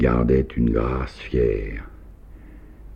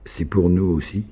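timid; 7 semitones higher than usual. A narrator's reading. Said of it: "Anyuta demanda timidement".

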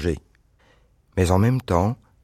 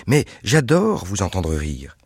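ordinary delivery, nothing marked

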